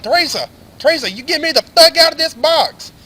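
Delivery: high, loud hollering for help; retold yelling. Trapped man yells to Teresa from the box, acted furious holler. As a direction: holler for Teresa in acted outrage, far above talking pitch. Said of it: "I'm yelling".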